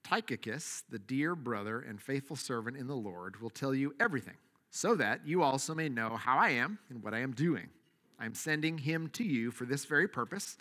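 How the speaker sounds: solemn public reading; scripture reading. Acted. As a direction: read the text with weight and care. Reads the scripture passage.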